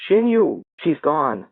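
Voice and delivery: high-pitched calling out; alarmed yell. A narrator's reading. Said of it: "Bo yells out".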